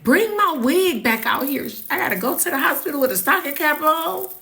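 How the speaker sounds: high excited pleading; urgent demand. Retold or imitated. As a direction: insist on the wig, flustered. Demanding wig, higher voice.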